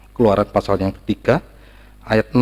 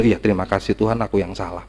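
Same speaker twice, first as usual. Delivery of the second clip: humble thanks; 4 semitones lower, 6 dB quieter than usual. Acted. Grateful meek reply to God.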